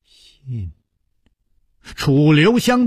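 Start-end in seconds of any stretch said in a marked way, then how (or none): 0.0-0.7 a soft, blank echo of the word; dazed. A narrator's reading. Widow blankly repeats the word, dazed and soft. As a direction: say it as if lost in thought. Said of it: "任夫人茫然说".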